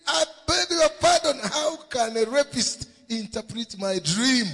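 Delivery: high, loud scandalised retort; king's indignant outburst. Acted.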